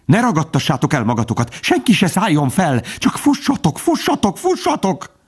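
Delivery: frantic high-pitched pheasant, rising to a squeal; beside himself, urging everyone to run; panicked cry. A narrator's reading. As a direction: climb from urgent cry into very high, panicky repeats. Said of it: "kiáltott az övéinek".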